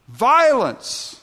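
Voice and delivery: high, loud cry of violence. Acted. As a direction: sound the alarm from the pulpit. Preacher crying out the word.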